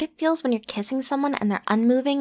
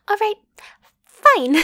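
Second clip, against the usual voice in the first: exasperated laugh; 8 semitones higher, 7 dB louder than usual. Acted.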